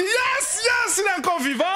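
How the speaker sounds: high, loud, fast cry of relief; excited laughing shout; relieved shout. Retold or imitated. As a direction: yell with joy that he lives.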